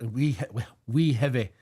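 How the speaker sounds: a quick put-on Scottish accent on "Wee Heavy"; a light mock Scottish accent. Shown as a demonstration. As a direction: say "Wee Heavy" like a Scot, rolling it out. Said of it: "This is my best Scottish accent".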